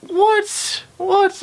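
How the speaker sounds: feigned disbelief when the student exposes the prank; mock shock. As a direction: act caught out, voice shooting up. Caught out by a student.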